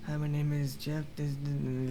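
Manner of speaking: imitating a barely audible caller, low and soft; quiet phone mumble. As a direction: mumble softly, trailing into mush. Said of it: "quietly talking on the phone".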